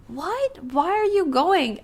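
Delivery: high, shocked cry, then drawn out; acting out a baffled actor calling after a partner who walks out; startled plea. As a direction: sound caught off guard as the partner storms out. Co-actor reacting to walk-off.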